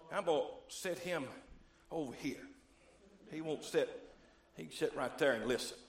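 low murmured aside to himself; quiet aside. Real, not acted. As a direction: drop the voice, just talking to yourself. Aside while placing the decoy.

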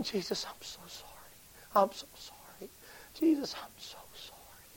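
slow, breathless whisper of sorrow; whispered apology. Retold or imitated. Prisoner whispering her repentance to Jesus, tearful whisper. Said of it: "That's her way of shouting".

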